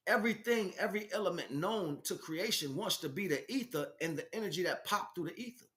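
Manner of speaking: ordinary delivery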